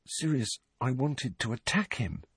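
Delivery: soft, scared near whisper; hushed confession. A narrator's reading. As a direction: confess it under your breath. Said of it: "a voice only a little above a whisper".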